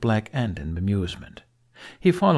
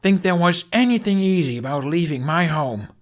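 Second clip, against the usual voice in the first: a raised, bitter comeback; bitter retort; 8 semitones higher, 6 dB louder than usual. A narrator's reading. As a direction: throw the question back at her, stung. Hiccup retorts during their standoff.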